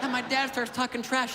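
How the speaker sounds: normal talking voice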